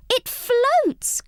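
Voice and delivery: high, delighted cry; thrilled it floats; a little mouse crying out in delight; cried out. A narrator's reading. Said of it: "cried Angelina".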